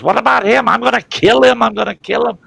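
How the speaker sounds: very high, loud imitation of an outraged sister; hammed-up falsetto shriek of protest; mock furious screaming. Sister demanding her brother be punished, screaming.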